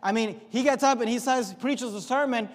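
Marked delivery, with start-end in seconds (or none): none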